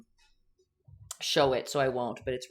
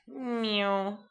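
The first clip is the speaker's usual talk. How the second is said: a higher, cutesy cat meow; silly cat sound effect; playful meow; 5 semitones higher than usual. Pretend. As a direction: meow like a cartoon kitten while painting. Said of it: "sound effects very necessary".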